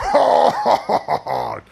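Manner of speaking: loud sinister villain laugh; menacing horror villain chuckle; Freddy Krueger laugh. Acted. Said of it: "our deluxe Freddy Krueger".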